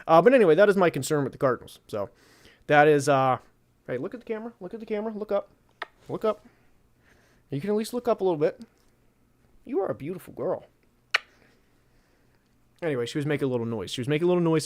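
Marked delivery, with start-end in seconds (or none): 3.9-10.7 high, soft pet talk; sweet-talking a pet; talking to the dog. Real, not acted. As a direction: coax the dog to look at the camera. Talking to his dog on camera.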